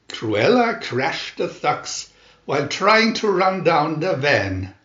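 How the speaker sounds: usual speaking voice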